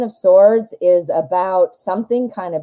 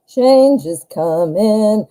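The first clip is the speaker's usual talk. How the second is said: slow, ominous and deliberate; 2 semitones higher, 3 dB louder, much slower than usual. Real, not acted. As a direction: draw it out like a warning. Repeats slowly, ominous.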